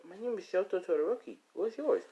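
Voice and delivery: put-on high-pitched kid introducing himself; child's voice. Shoto introducing himself to a child, high kid voice. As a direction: introduce yourself like a small child.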